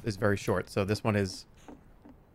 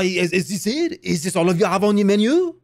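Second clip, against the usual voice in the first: higher-pitched, put-on French accent; 5 semitones higher, 3 dB louder than usual. Shown as a demonstration. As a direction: ask it like a Frenchman, accent rising at the end. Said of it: "say in a French accent".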